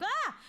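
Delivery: short, high startled "ah"; startled yelp. Retold or imitated. Telling of bumping into strangers in a haunted mansion, startled yelp.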